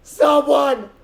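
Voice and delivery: high, pleading cry for help; far-off woman calling out for help; woman screaming for help. Retold or imitated. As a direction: shriek it like a distant woman in danger. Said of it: "I heard a woman screaming for help".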